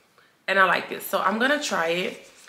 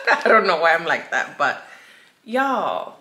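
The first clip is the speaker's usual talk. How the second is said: laughing; 5 dB louder than usual. Real, not acted. Laughing at herself.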